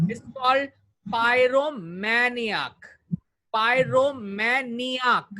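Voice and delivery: pyromaniac said slowly, stress on ma; slow model word. Shown as a demonstration. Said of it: "this is the pronunciation key of pyromaniac". (धीमा उच्चारण, "pyromaniac" में ma पर ज़ोर)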